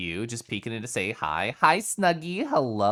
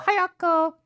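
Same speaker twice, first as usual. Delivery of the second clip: squeaky cutesy greeting; 5 dB louder than usual. Pretend. Greeting chatter in high voice.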